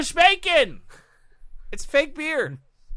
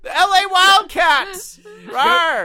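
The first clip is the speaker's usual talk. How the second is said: team cheer; 8 semitones higher, 6 dB louder, slower than usual. Acted.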